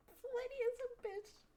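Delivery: high, cackling delight at the rude option; gleeful insult. Real, not acted. Player choosing the insult option, gleeful. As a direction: relish trashing the lady.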